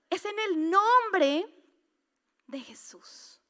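drawn-out "Es en el nombre" then whispered "de Jesús"; slow down, then drop to a whisper on "de Jesús"; dramatic hushed ending; slow reveal to a whisper. Acted.

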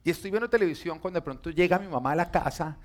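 ordinary delivery

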